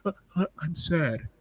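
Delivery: mock sad. Mocks the character's self-pity.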